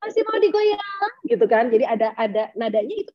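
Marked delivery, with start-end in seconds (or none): 0.0-1.1 high-pitched, drawn-out party call; dangdut MC. Shown as a demonstration. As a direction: rouse the crowd like a dangdut host. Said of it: "pasti bilangnya".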